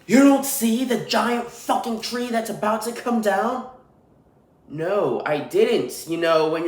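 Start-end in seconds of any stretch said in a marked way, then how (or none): 0.1-3.7 imitating a yelling worker. Road worker yelling after a passing driver, high mock yell. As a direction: yell it like the angry tree guy. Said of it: "he then starts screaming at me".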